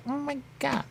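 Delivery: high, startled "oh my God"; mock-shocked cry; shocked exclamation. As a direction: yelp it in disbelief. Reacting to game event.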